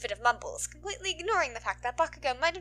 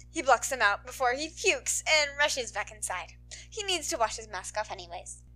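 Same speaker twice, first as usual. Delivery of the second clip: plain reading voice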